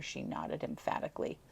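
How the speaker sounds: plain reading voice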